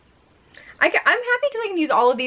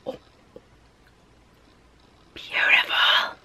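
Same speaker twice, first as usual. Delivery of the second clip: whispered in awe; 3 dB quieter than usual. Real, not acted. Admiring a swatch to viewers, whispered. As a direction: breathe the word softly.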